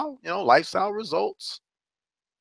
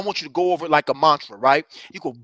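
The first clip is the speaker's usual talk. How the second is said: usual speaking voice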